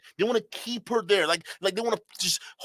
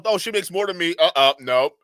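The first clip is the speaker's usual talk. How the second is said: louder mocking put-on voice of a worried guy; hammed-up paranoid husband voice; mock insecure husband; 2 semitones lower, 5 dB louder than usual. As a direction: parody a paranoid man, louder. Mocking men who fear earning wives.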